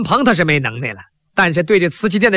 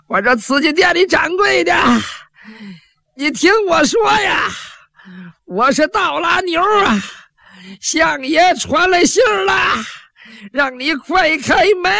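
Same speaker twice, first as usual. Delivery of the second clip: yelling at the top of his voice; 10 semitones higher than usual. Acted. Thug calls to the shopkeeper to open up, high shout. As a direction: bellow across the street so the shop hears. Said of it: "这小子这句话一喊出来".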